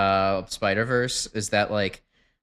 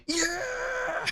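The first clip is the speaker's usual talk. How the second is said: very high, thin mock scream; background crowd scream; 9 dB quieter than usual. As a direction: scream it like a distant cheering crowd. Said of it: "everybody else is screaming".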